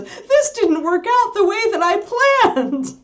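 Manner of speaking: high, slow, dismayed puppy voice; mock horrified puppy. Owner voicing puppy whose breakfast plan failed, high slow dismay. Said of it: "the horrified look on the puppy face".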